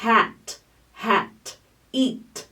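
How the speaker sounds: hat, hat, eat ending in a clean T with no extra vowel; clean final T. Shown as a demonstration. Fonoaudióloga mostrando o T final limpo. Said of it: "no final das palavras também é um som limpo".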